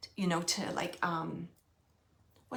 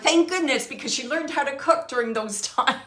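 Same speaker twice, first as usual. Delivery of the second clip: higher, louder mock relief with a laugh; loud, amused retelling; 5 semitones higher, 10 dB louder than usual. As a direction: relay the joke brightly, breaking into a chuckle. Amused medium relays husband's grateful joke.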